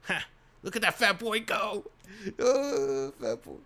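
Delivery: high, loud old woman mocking the fleeing boy; old woman jeering as the bullies flee, cackling crone. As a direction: crow with glee at the runaway. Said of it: "barked out a single laugh".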